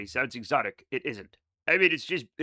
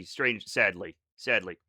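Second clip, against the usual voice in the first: real low voice; 9 semitones lower, slower than usual. Real, not acted. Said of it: "this is the real me".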